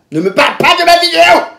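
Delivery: high, loud retold outburst; angry yell. Snapping at her date about her videos, angry high yell. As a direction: explode into a high shout.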